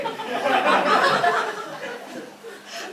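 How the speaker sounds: high, breathy laughter; real laugh at the joke. Real, not acted. Speaker laughing about chocolate and roses, high and breathy.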